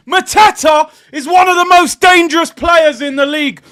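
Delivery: a real fan erupting at a conceded goal; furious shouting. Real, not acted. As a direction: rage at the team at full volume. Fan raging at his team's goal conceded.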